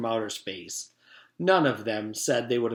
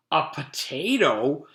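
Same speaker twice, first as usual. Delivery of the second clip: slow, drawn-out disbelief; surprised exclamation; 3 semitones higher, 4 dB louder, slower than usual. A narrator's reading. Leslie seeing Calvin's ankle tattoo, amazed. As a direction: stretch it out in astonishment. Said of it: "exclaimed Leslie".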